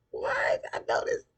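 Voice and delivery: a squealed high, loud what, then a whisper; shocked. Retold or imitated. Dre shocked at being checked, loud squeak then whisper.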